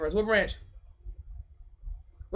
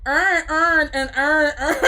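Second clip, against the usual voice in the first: higher, louder "urn urn", mock Baltimore; imitated Baltimore accent; 6 semitones higher, 4 dB louder, slower than usual. Streamer mimicking a clip, exaggerated Baltimore accent. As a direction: collapse every word to "urn", Baltimore style. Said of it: "And they would be like".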